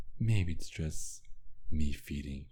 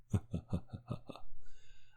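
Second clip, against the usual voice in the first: breathy villain chuckle; 3 semitones higher, 4 dB quieter than usual. Acted. Villain mocks his captive.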